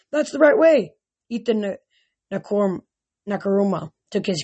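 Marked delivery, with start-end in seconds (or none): 0.1-0.9 urgent cry. A narrator's reading. Rachel urging them on.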